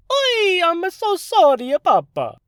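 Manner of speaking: very high, loud, hammed-up Italian whine; over-the-top Italian accent. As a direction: burst in cartoonishly on a sad scene. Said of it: "burst out and say".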